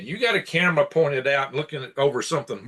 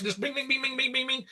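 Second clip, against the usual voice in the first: imitated clicking sounds; 12 semitones higher, 6 dB quieter than usual. Imitating computer clicking randomly.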